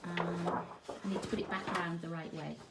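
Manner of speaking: ordinary talk, nothing marked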